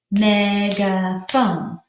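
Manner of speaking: "Megaphone" slowly, in three separate beats; "Megaphone" stretched into three even, slow syllables; syllables pounded out. Shown as a demonstration. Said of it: "pound out the syllables".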